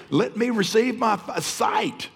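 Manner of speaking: high pleading cry; desperate plea. Retold or imitated. Blind man begging Jesus for his sight, high cry.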